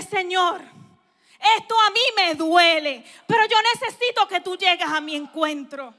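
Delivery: loud, raw plea to God; a fervent, pleading prayer. Retold or imitated. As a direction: cry out to the Lord from the floor. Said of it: "te atreves a caerte al piso y decirle".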